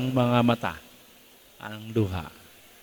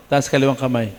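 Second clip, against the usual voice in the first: ordinary delivery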